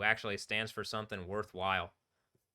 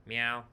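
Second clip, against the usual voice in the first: meowing like a cat; 3 dB louder than usual. Pretend. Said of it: "if they know cat".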